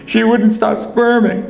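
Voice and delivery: high, voiced-up tearful little girl; sobbing child. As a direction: speak as a crying little girl. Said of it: "she sobbed".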